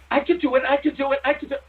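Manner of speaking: put-on high, eager kid pleading; impatient kid begging to go. Host imitating eager Joe, high and pleading.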